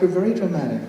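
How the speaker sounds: ordinary delivery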